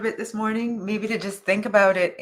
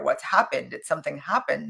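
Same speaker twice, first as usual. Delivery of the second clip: ordinary talk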